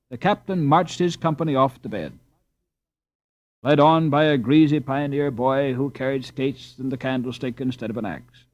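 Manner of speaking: plain reading voice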